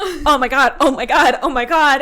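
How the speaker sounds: loud repeated oh my God; overwhelmed. Retold or imitated. Bride reliving the proposal, loud overwhelmed repeats. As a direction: gush it out, stunned. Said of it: "I was just".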